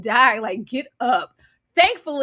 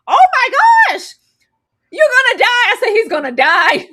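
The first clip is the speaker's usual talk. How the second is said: high, loud horrified cry; hammed-up viewer panic; acted panic; 10 semitones higher, 9 dB louder than usual. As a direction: shriek in dismay at the fight. Reenacting panic while watching fight.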